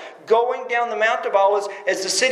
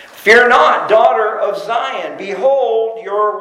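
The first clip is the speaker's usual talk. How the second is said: louder, slow proclamation of the verse; preaching scripture; 6 dB louder, slower than usual. Real, not acted. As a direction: proclaim the prophecy to the congregation. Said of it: "preaching scripture aloud".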